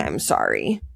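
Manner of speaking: low, soft mocking "I'm sorry"; mock-sincere sorry; mocking apology. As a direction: sneer at the empty apology. Mocking Amanda's fake apology.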